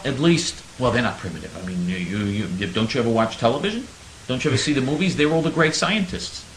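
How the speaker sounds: normal talking voice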